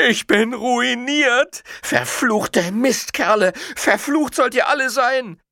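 very high, drawn-out wail; frantic, high-pitched merchant's lament; despairing cursing cry. A narrator's reading. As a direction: curse the crowd in ruined despair. Robbed merchant wails and curses.

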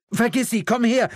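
mother's loud, high-pitched order; urgent yelled command; commanding shout. A narrator's reading. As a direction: order your child over to you, urgently. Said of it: "befahl ihre Mutter".

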